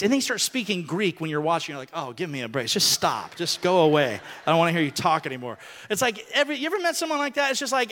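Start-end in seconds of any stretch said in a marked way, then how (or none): 1.9-5.6 mock exasperation. Mock annoyed reaction.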